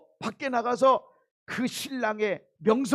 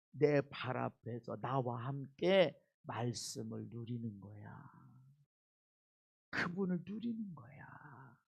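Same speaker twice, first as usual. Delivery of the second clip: tender; 10 dB quieter, slower than usual. Acted. Jesus' tender invitation, preaching.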